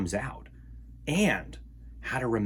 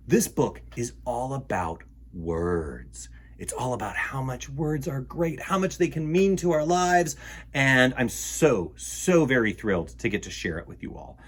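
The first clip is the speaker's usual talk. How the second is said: plain reading voice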